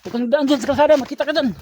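high, loud urgent warning; urgent shout. Acted. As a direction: yell a warning in a hurry. Warning while fleeing.

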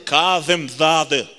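کاظم زاده slowly, with lisped th-like ظ and ذ; thrown-out endings. Acted. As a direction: draw out کاظم زاده and launch each final syllable. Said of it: "همش آخرش پرتابیه". («کاظم زاده» کشیده با آخر پرتابی)

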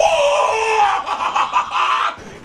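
high, rattling laugh; triumphant cackle. Real, not acted. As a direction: cackle at the beaten opponents. Gleeful at kills.

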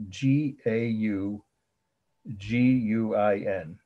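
slow letter-by-letter spelling. Real, not acted. Teacher spelling the last artist's name for students, spelling out.

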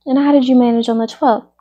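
ordinary delivery, nothing marked

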